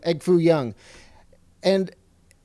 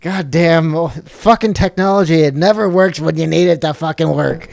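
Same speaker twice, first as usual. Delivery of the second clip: real exasperated outburst; 3 semitones higher, 8 dB louder than usual. Real, not acted. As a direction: curse the glitching gear at the top of your voice. Camera glitch mid-call, cursing the technology.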